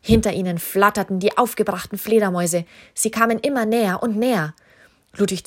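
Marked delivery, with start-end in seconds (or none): none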